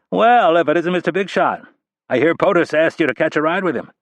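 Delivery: a smartass friend needling him; teasing colleague. Acted. Ridley teases Rapp on phone.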